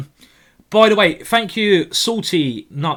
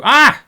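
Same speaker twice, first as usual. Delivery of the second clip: startled cry; 9 semitones higher, 8 dB louder than usual. Real, not acted. Reacting to blunder.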